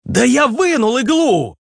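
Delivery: high, drawn-out exasperated yell; barking. A narrator's reading. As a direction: bark it out, pitched very high. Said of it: "Рявкнул Руслан". (рявкнуть высоко и протяжно)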